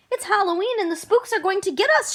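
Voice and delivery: scared yell. Acted. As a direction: cry out as if the spooks are coming. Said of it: "she yelled".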